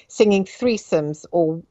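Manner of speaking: ordinary delivery, nothing marked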